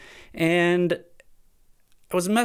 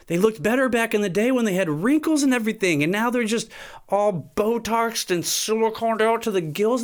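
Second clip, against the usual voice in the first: worked-up rant; 9 semitones higher than usual. Real, not acted. Ranting about Botox faces.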